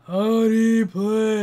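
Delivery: drawn-out imitation of a booming call; mock boom, stretching both words. Friend waking her from sleep, mildly booming imitation. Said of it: "this really deep booming".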